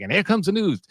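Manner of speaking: high-pitched old radio newscaster impression; Walter Winchell. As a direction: build tension like a news bulletin intro. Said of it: "to create that tension".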